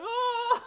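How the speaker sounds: put-on high-pitched childish "ugh"; kids' sulky groan. Toddlers groaning after mom scolds them, high sulky ugh. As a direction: mimic a pouting toddler.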